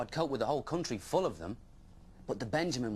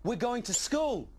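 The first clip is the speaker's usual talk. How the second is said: high, louder, slow and bright; eager young dragon telling the Benjamin; excited announcement; 13 semitones higher, 4 dB louder, slower than usual. Acted. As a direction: announce it like a treat.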